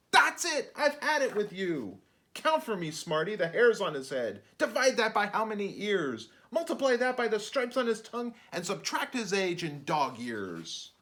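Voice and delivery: hammed-up exasperation; fed-up outburst. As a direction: challenge the know-it-all. Narrator loses patience, quizzes.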